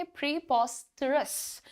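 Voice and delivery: प्रीपोस्टरस said slowly, syllable by syllable; careful pronunciation. Shown as a demonstration. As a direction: spell out प्रीपोस्टरस slowly for the class. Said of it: "pronunciation देखिए". (धीमा, साफ़ उच्चारण)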